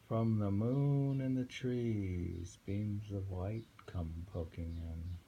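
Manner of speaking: slow, drawn-out poem recital; poem reading. Acted. Reading a hermit's poem to a listener, slow recital. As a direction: let the loneliness hang, slowly.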